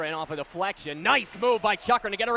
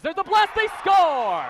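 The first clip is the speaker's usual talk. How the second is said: excited goal call; 9 semitones higher, 9 dB louder, slower than usual. Real, not acted.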